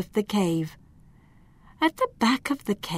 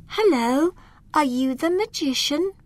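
higher, polite little voice; polite elf; 8 semitones higher than usual. Acted. Lila greeting the old frog, high and polite. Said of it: "Lila said".

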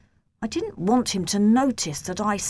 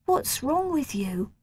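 a little sad; 7 semitones higher than usual. A narrator's reading. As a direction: ask with a touch of hurt. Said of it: "Simon's voice sounded a little sad".

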